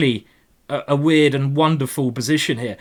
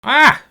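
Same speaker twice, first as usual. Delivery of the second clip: startled cry; 9 semitones higher, 7 dB louder than usual. Real, not acted. Reacting to opponent's attack.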